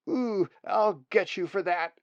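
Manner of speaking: put-on a kid vowing revenge on a sibling; childish threat.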